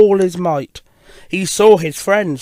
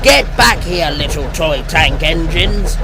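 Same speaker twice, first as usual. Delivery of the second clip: booming villain shout; loud, menacing big-engine voice; Diesel ten; 5 dB louder than usual. Acted. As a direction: bellow it after fleeing prey. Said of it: "Diesel ten's booming voice rang out".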